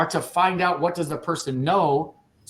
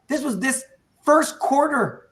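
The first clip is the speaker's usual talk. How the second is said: higher, slower, louder on the big sales figure; pumped, punching out each number; fired up; 7 semitones higher, 3 dB louder, slower than usual. Real, not acted. Trainer firing up agents over the quarter's sales total. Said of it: "I know I'm getting fired up".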